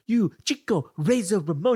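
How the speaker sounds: light mock Cuban accent aimed at a rival. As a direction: taunt him in a put-on Cuban lilt. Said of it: "He's, like, mocking him".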